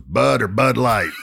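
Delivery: mock gruff bartender. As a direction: tough small-town barman, gruff. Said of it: "He goes".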